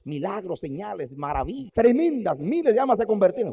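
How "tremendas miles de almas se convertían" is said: rising fervor. Real, not acted. Preacher building to a climax.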